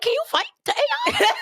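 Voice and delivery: very high, laughing question; laughing challenge. Retold or imitated. Daring the AI to fight, high laughing squeal. Joking upset at roast.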